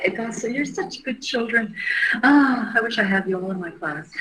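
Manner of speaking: lightly voiced kindergarten teacher; teacher praising class. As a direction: coo at them like a pleased teacher. Praising viewers like schoolchildren.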